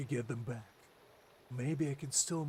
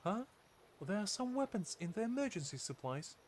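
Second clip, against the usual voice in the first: higher, irritated companion voice; high character voice; 6 semitones higher than usual. Acted. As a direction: complain in a higher register. Same companion voice, urgent.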